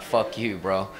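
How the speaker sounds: low, soft mock refusal from a dog; muted defiance. Pit bull refusing its owner who yanks the leash, low quiet defiance.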